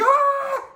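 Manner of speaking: very high, loud shrieked "Ah!"; retold panicked shriek. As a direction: squeal in fright like she did at the bee. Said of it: "she flips the fuck out".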